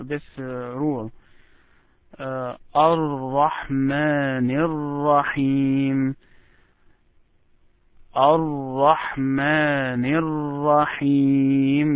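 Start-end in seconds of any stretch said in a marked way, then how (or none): 2.7-11.9 slow, drawn-out Quranic recitation demo; connected recitation. Shown as a demonstration. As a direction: join Ar-Rahman into Ar-Rahim in one held, unbroken line. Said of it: "The way you connect Ar-Rahman to Ar-Rahim".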